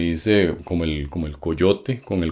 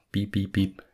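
phone beep imitation; 3 semitones higher, 6 dB quieter than usual. Showing how the lost handset sounded, imitated beeping. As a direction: beep like a cordless phone locator. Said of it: "El teléfono empezó a sonar".

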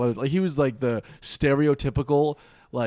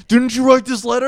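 loud, raised-pitch accusation; angry mock questioning; 10 semitones higher, 9 dB louder than usual. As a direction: confront him as the irate boyfriend. Acts out boyfriend confronting friend.

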